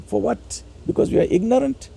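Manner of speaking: usual speaking voice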